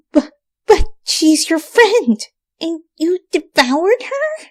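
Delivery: high, slow, horrified; aghast stammer. A narrator's reading. Twilight asks Rainbow how she could eat a friend. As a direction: stammer out the disbelief.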